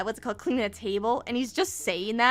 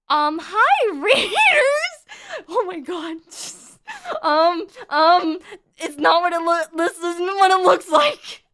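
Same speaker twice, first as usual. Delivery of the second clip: loud, higher, laughing and stumbling; flustered panic; 6 semitones higher, 10 dB louder, slower than usual. Real, not acted. Streamer just got raided mid-joke, loudly flustered. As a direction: blurt a flustered excuse to the newcomers.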